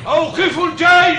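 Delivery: loud high command to halt; shouted order. Acted. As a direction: bark it down the ranks. Order relayed to halt the army.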